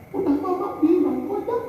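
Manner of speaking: a fussy mom's high whine; nagging mother. Retold or imitated. Mother scolds again. (suara ibu yang melengking pelan)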